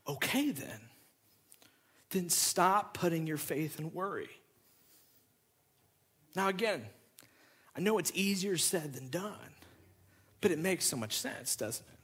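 soft and quiet. Real, not acted. Pastor to the congregation, softly urging.

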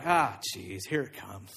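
low, soft, resigned grumble; muttered dread. Acted. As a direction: grumble to yourself as trouble approaches. Levi dreads Jesus approaching.

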